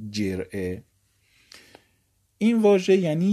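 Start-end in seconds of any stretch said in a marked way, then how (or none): 0.1-0.8 جرعه said as jer'e, falling; reading with e. Shown as a demonstration. Teacher listing the three vowel readings, jer'e last. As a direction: finish with جرعه as jer'e, e after the j. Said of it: "مثلث الفاء یعنی".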